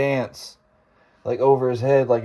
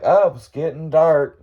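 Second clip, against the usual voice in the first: louder, higher, drawn-out casual remark; feigned nonchalance; 6 semitones higher, 5 dB louder than usual. Retold or imitated. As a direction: act casual, as if nothing is wrong. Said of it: "I wasn't gonna show it".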